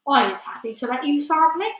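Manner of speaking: sing-song friendly giant voice; coaxing giant. Acted. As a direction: tempt the listener with a lifted lilt. Giant coaxes rival to taste.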